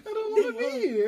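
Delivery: very high crying whine; mock crying. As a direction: whimper like a baby.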